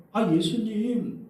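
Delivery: high-pitched imitation of begging disciples; exasperated, imploring appeal, acted out; acted pleading. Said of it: "제자들이 예수님께 간청을 합니다".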